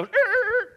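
high, loud brake screech; screeching brakes noise. Acted. Preacher mimicking the angel braking on doubt, screeching sound. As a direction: imitate an angel skidding to a halt. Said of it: "and he breaks again".

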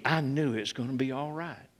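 quiet conviction. Real, not acted. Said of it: "from the depth of my being".

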